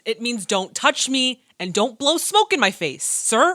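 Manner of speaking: loud, high indignant scolding. Real, not acted. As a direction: scold him loudly. Indignant rebuttal of the suitor.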